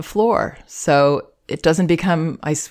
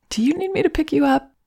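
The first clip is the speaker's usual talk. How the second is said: put-on much higher, quick and tender, asking the baby; gentle question; 12 semitones higher, faster than usual. Shown as a demonstration. Parent with hands out to crying baby, high and gentle. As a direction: lift your voice to invite her. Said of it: "really giving it that moment".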